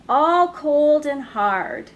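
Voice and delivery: ordinary delivery, nothing marked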